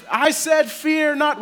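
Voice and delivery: high, loud mock-angry command; exasperated angel shout. Fed-up kid angel scolding shepherds, mock shout. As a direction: lose patience and shout it. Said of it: "And I said".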